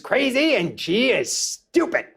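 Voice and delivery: lightly voiced Stan Lee, annoyed and drawn out; indignant Stan Lee. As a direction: protest that you said it right, slowly. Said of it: "he goes".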